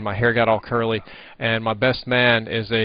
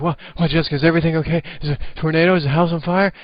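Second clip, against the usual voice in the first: mock panic; 6 semitones higher, 4 dB louder, faster than usual. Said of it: "I was like".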